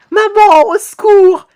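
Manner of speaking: high, loud acted wail; panicked cry for help. Frightened victim calling for mother, high loud panicked cry.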